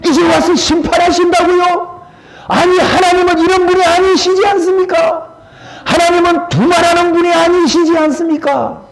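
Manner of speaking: high, urgent protest to God; pleading protest. Acted. Moses protesting to God in the pulpit, high and urgent. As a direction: plead in disbelief, pitch climbing.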